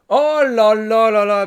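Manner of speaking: high, slow stretched exclamation; delighted astonishment; amazed oh là là. Real, not acted. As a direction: marvel aloud. Amazed at the story.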